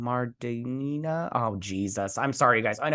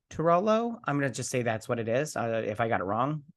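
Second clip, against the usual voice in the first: ordinary delivery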